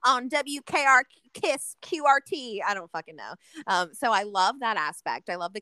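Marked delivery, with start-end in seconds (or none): 0.1-2.6 a light Southern radio DJ drawl, higher and louder; chirpy Texas radio host greeting the listeners in a Southern accent. Retold or imitated. Imitating the Texas radio DJ Stretch to a friend, light Southern accent. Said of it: "a Southern accent 'cause it's Texas".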